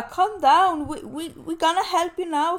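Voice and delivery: high, loud mock of a dismissive clerk; mimicking the shop clerk telling her to calm down. Mocking shop clerk's reply.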